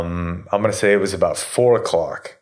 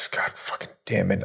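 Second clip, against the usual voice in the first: muttered, much softer, a quiet groan of annoyance; exasperated curse under breath; 10 dB quieter than usual. Retold or imitated. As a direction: sigh it out almost to yourself. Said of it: "and I was like".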